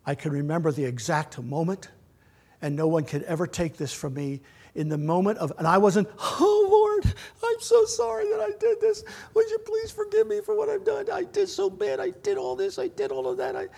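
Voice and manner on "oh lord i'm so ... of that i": much higher, strained, tearful begging for forgiveness; pleading, crying prayer. Retold or imitated. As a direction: beg God for mercy in a high, near-breaking voice. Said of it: "And I'm crying and carrying on".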